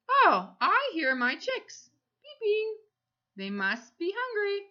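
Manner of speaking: bright mock surprise for kids; excited high voice. Shown as a demonstration. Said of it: "peep like a chick?".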